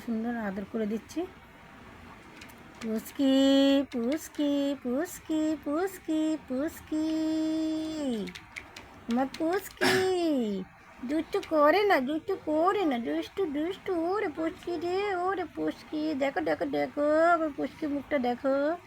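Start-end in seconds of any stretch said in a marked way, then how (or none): 2.8-10.6 soft, high sing-song calling; tender, lilting call to a pet; affectionate cooing call. Real, not acted. 11.1-18.8 higher, softer cooing to a pet; affectionate sing-song pet talk. Real, not acted. Fond baby talk to pet.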